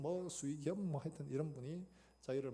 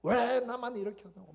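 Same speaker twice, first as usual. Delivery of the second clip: shouted much higher and louder, blaming God; angry rant at God; 9 semitones higher, 10 dB louder than usual. Retold or imitated. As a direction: hurl the blame at heaven, fist raised. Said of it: "하늘을 향해 삿대질을 하면서 막 하나님한테 막 말합니다".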